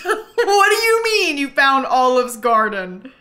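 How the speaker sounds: loud, slow, laughing question; amused loud what; laughing disbelief. Real, not acted. Game surprise at a silly find.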